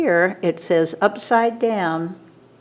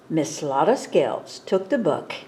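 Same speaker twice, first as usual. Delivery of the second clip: ordinary delivery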